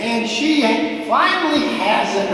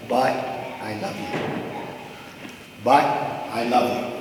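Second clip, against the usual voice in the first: much softer, lower, gentle and tender; tender mother; 9 semitones lower, 6 dB quieter, faster than usual. Retold or imitated. Mother to son after the scolding, quietly tender. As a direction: let it land softly, like a hug after the scare. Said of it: "she says".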